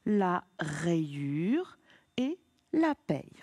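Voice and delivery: slow syllable-by-syllable demo; slow reading. Shown as a demonstration. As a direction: read la rayure et la paye very slowly. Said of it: "on décompose le Y en deux I".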